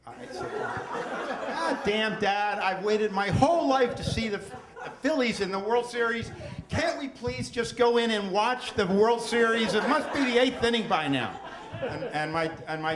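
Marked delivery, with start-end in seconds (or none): 0.5-11.4 laughing half-shout, higher and louder; bursting out at Dad, half laughing; retold outburst. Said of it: "I just lost it".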